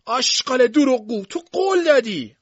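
high, loud furious scream; screaming shout. A narrator's reading. Sister screams at brother who broke his promise, shrieking high and loud. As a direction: shriek it after the fleeing carriage.

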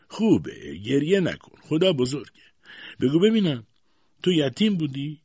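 loud, high, bossy questioning; brusque aunt. A narrator's reading. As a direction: cut the crying short and interrogate. Brusque aunt comforts, then interrogates.

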